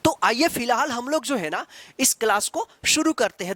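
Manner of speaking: ordinary talk, nothing marked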